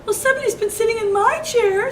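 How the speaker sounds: a shrieking high mama voice, upset; Mama Bear. A narrator's reading. Reading to kids, Mama Bear's chair was sat in. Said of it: "said Mama Bear".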